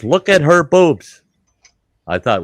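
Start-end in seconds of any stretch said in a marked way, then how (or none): 0.0-1.2 retold blurt. Doctor repeats it, retold.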